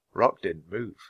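ordinary delivery, nothing marked

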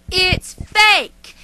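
loud, high exasperated shout; overacted anime dub. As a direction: yell it at him in frustration. Cat snaps the truth.

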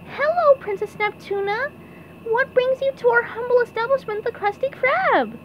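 high cartoon courtly greeting; SpongeBob voice. A narrator's reading. SpongeBob bowing to the princess, high formal welcome.

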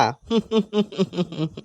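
soft run of chuckles; quiet, drawn-out laugh; chuckling. Acted. Pupil jokes about not studying, chuckling.

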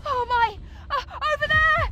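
startled cry, pitched high and loud; alarmed cry. Acted. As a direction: cry out at a sudden threat. Sudden alarmed sight in battle.

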